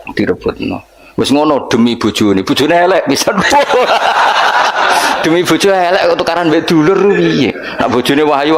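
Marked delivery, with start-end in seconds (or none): 3.4-5.2 laugh. Real, not acted. Laughs at ugly-wife joke.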